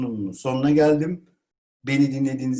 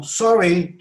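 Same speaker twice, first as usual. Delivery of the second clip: mildly raised cry; exclaimed apology; 6 semitones higher, 7 dB louder than usual. Shown as a demonstration. Teacher models apologising when upset, exclaimed. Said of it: "haykırarak söylüyorsunuz".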